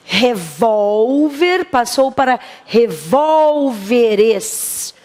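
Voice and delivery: "Revólver" then "revólveres", slowly, marking the moved stress; slow stress demo. Shown as a demonstration. Teacher contrasting singular and plural for the class, slow. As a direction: spell out the shift of the stressed syllable. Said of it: "A tônica não está mais no mesmo lugar".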